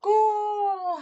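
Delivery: high, joyful cheer; cheering goal. Acted. Player scores and cheers, joyful. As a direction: celebrate the goal.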